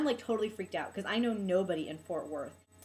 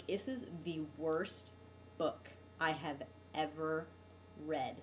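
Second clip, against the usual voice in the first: slow, low, word-by-word verdict; emphatic condemnation; 4 semitones lower, 6 dB quieter, slower than usual. Real, not acted. Teacher condemning her online class book, slow and deliberate. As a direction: drag it out, each word set apart.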